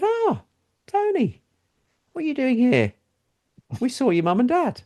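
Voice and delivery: high, delighted recognition; surprised greeting. Retold or imitated. Strangers mistaking him for Tony.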